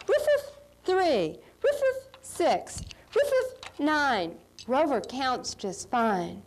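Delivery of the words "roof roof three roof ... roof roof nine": high playful dog barking numbers. Pretend. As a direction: bark like a cheerful dog. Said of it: "He goes".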